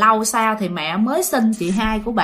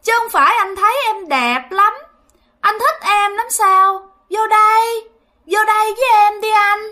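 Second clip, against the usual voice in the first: ghostly woman's voice; 10 semitones higher, 3 dB louder than usual. Retold or imitated. Ghost luring the young man into the empty house, eerie and high. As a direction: coax him inside like a haunting spirit. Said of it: "nghe cái giọng cô nó nói rằng".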